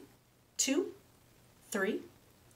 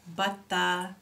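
plain reading voice

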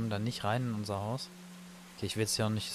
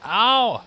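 sharp loud high ouch; pained yelp; 10 semitones higher, 12 dB louder than usual. Real, not acted. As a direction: yelp out in sudden pain. Hit by monster in game.

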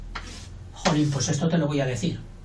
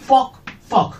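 knocking noise; 7 semitones higher, 4 dB louder than usual. Retold or imitated. Mock knock on a door.

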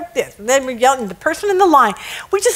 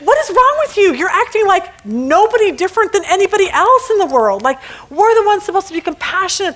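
onlookers scolding; 6 semitones higher than usual. Retold or imitated. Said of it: "people behind are like".

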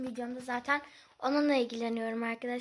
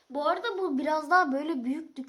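usual speaking voice

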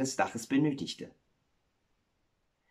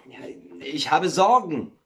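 firm, brief reply; curt; 4 semitones higher, 4 dB louder than usual. Acted. Miller brushes off his wife's question, curt. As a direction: answer shortly, without explaining. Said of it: "antwortete der Müller kurz".

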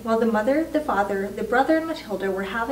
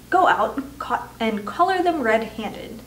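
usual speaking voice

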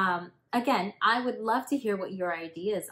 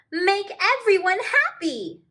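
cheery okapi voice; 14 semitones higher, 10 dB louder than usual. Acted. Okapi voiced in picture book.

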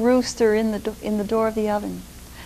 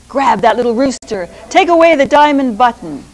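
louder Sultan barking orders; commanding Sultan; 9 dB louder than usual. Acted. Sultan telling his three servants to grab the rooster, loud.

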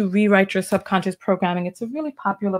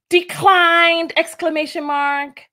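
gleeful announcement; 9 semitones higher than usual. Retold or imitated. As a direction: announce it like breaking news. Reading text message aloud gleefully.

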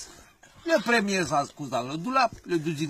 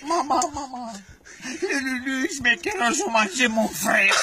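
put-on higher, laughing child voice; little kid voice; 5 semitones higher than usual. Little goat tells mother the wolf ate his brother, higher and giggling.

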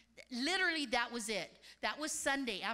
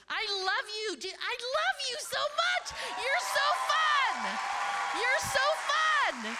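much higher and louder, slow and drawn out; shower him with delighted love; gushing affection; 9 semitones higher, 7 dB louder than usual. Real, not acted. Preacher gushing to Pastor Jimmy, loud and delighted.

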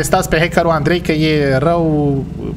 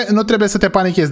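normal talking voice

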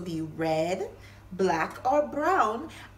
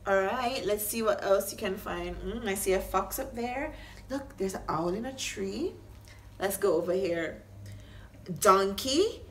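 usual speaking voice

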